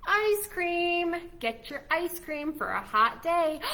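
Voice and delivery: lightly voiced vendor calling out; ice cream seller call. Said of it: "Elephant and Piggy have to say".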